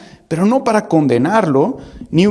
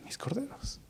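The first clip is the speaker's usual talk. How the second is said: whispered command; 7 semitones higher, 22 dB quieter than usual. Retold or imitated. Quoting Jesus' command to Peter.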